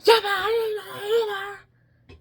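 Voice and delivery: high, loud tearful yell; crying high-pitched scream; retold screaming back. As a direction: shout back like the upset teacher. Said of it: "she starts screaming back at him".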